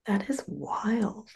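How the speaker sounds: slow, whispered amazement; hushed buzzword. Shown as a demonstration. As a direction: whisper it slowly, as a trendy catchphrase. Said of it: "I've started to say things like".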